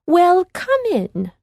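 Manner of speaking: high, slow, sugary invitation; old woman's welcome. A narrator's reading. Old woman welcomes lost children in, high and slow. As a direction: coax them inside like a sweet old lady. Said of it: "replied the woman".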